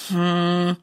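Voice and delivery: low grumbling hmm; boy answers his mum with a sulky hum; grumpy hum. A narrator's reading. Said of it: "brummt Silas nur".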